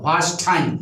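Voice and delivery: low, slow English-style "was time", words fused; imitated English accent. Shown as a demonstration. Teacher shows students an Englishman's question, imitated English accent. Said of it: "ऐसे बोलेगा".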